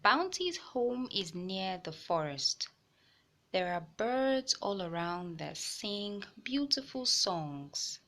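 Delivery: put-on gentle read-aloud voice for kids; storybook reading for young listeners; story narration. A narrator's reading. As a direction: tell it like a picture book to children. Reading a picture book aloud.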